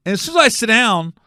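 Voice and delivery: normal talking voice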